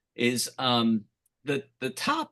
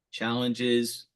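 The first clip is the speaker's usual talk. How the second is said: usual speaking voice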